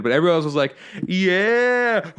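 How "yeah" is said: high drawn-out cheer, breaking into laughter; crowd cheering. Retold or imitated. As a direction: imitate a whole crowd loving it. Imitating the crowd loving the joke.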